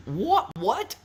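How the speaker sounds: high, rising, incredulous what; bewildered double-take; baffled. Real, not acted. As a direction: squeak it upward in total confusion. Baffled by a garbled question.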